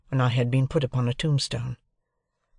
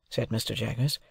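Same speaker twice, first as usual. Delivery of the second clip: plain reading voice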